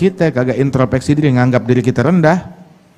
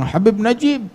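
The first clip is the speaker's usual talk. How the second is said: raised-pitch mock grumble; imitated complaint; 6 semitones higher than usual. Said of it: "Ada orang bilang".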